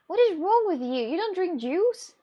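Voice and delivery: high, loud, incredulous questioning; mock disbelief. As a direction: act appalled at the other person. Mock disbelief over not drinking juice.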